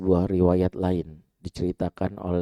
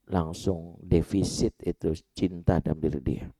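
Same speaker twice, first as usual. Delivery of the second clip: ordinary delivery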